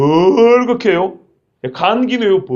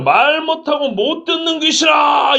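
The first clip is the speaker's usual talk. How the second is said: commanding shout; 9 semitones higher, slower than usual. Retold or imitated. Jesus casts out the deaf and mute spirit, slow high shout. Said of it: "이렇게 말했어요".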